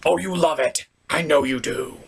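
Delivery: normal talking voice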